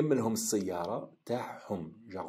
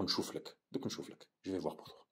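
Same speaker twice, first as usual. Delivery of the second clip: whisper; 9 dB quieter, faster than usual. Acted. Someone quietly promising a favour.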